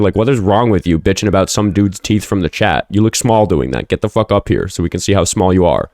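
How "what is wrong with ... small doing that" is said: usual speaking voice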